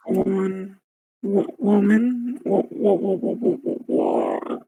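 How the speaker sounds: high voice warping and breaking; morphing voice. Real, not acted. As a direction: voice changer glitching. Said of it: "When you get, like, super gravelly".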